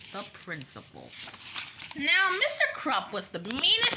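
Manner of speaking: ordinary delivery, nothing marked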